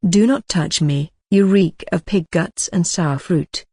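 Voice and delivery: usual speaking voice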